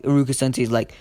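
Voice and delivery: plain reading voice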